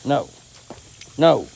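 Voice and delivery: loud, firm dog command; sharp call to a dog. Real, not acted. Scolding dog loudly.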